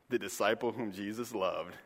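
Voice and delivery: quiet, self-effacing correction; John correcting himself as he writes, quietly; humble correction. Retold or imitated. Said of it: "no, not John".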